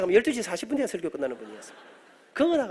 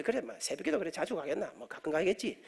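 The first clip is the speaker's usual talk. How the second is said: low-volume talk to himself; reluctant inner muttering; 3 semitones lower, 10 dB quieter than usual. Retold or imitated. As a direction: reassure yourself under your breath, much softer. Reluctant inner reasoning before agreeing. (혼잣말하듯 작게 중얼거리며)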